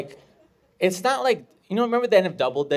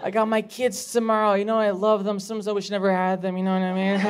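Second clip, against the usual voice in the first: lightly voiced, pitched-up excuse maker; acted-out woman; 7 semitones higher, 4 dB louder than usual. Woman making excuses to the comedian about tomorrow, mock dismay.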